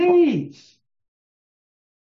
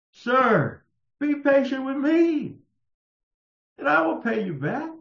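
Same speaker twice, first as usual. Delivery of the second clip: higher, pleading servant's plea; begging fellow servant; 8 semitones higher than usual. Acted. Fellow servant on his knees begging for patience, pleading.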